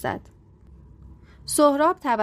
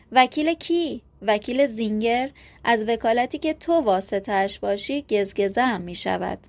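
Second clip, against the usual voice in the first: ordinary delivery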